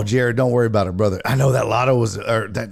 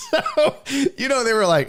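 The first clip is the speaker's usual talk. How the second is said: usual speaking voice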